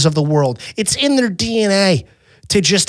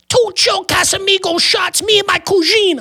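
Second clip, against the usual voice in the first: very high, braying tourist voice; obnoxious American tourist; 14 semitones higher than usual. Retold or imitated. Tourist ordering shots from the waiter, high mock voice.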